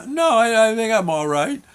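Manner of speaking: put-on high, hesitant kid's answer; meek child. Bundled-up kid answering mom, meekly backing down. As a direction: back down in a small high voice.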